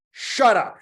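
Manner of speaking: high, loud angry snap; snapped. Acted. Julian snaps back at the monkey, loud and angry. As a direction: cut him off hard. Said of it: "Julian snapped back".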